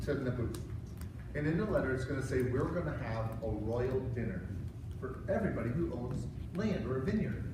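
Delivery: wife quietly laying out her plan to the king; secret scheming. Acted. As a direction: lean in and share the scheme quietly.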